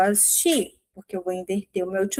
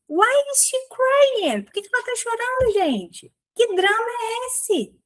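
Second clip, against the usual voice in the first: much higher, squeaky nosy gossip voice; gossipy mock outrage; 12 semitones higher than usual. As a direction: squeal it like a busybody who loves drama. Gossip voice mocking the crying woman. (fofoqueira indignada, voz bem mais aguda)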